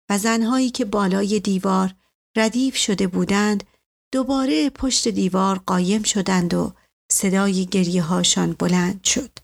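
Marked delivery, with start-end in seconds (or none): none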